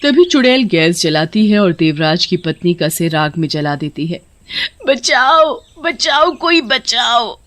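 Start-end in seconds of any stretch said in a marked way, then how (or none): 4.9-7.4 high-pitched desperate cry; screaming for help. Acted. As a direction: cry out in agony for rescue. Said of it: "बुरी तरह से चीखने लगती है". (चीखकर मदद मांगना)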